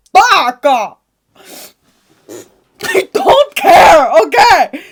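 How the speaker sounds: loud, high diva rant; sassy loud rant. Acted. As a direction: blow them off, loud and high.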